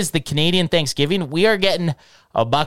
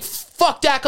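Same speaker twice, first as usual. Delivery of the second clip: higher-pitched mock heckle; imagined heckler; 9 semitones higher than usual. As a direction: toss it out like some guy in the stands. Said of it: "if some guy's like".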